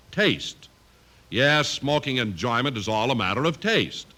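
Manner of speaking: normal talking voice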